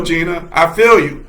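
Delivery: ordinary talk, nothing marked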